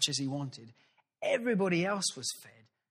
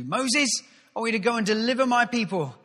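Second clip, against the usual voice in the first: loud, high voice of the Lord calling out; booming divine call; 8 semitones higher, 9 dB louder than usual. Acted. God calling Moses from the burning bush, loud and high.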